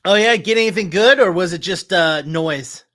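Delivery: loud, high, quick gate guard; gate guard in character. Acted. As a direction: call it out to the arriving driver, easing off by the end. Voices gate guard greeting the driver.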